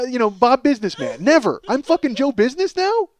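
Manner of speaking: normal talking voice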